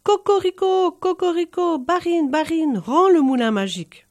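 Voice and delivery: high, loud crowing rooster call; loud rooster crow calling to the barine; rooster's crowing cry. A narrator's reading. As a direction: crow it out at the top of your lungs. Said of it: "se mit à crier".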